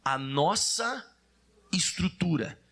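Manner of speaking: very slow, phrase by phrase for the congregation; deliberate recitation, pausing so others can join; low, measured call to recite together; leading in unison. Shown as a demonstration. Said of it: "fale junto comigo essa frase".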